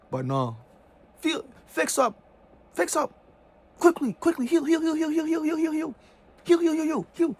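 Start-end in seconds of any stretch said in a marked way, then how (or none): 1.2-7.4 high, loud mocking nagging voice; high loud mocking chant of heal. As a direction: sneer it out in a shrill parody of the oppressor. Mocking the oppressors' demand to heal.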